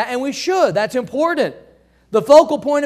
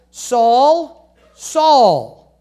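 calling out; 4 dB louder than usual. Acted. Jesus calling from heaven.

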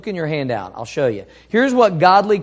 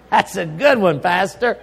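mocking laugh. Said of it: "You say".

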